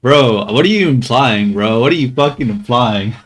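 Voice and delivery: loud, half-joking indignant shout; mock outrage. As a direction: play offended at the chat, loudly.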